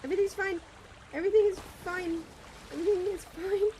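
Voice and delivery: high, loud insisting everything is fine; frantic self-reassurance; panicked repeat. Real, not acted. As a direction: chant it in rising panic.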